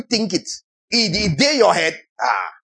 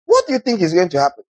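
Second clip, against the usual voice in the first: ordinary talk, nothing marked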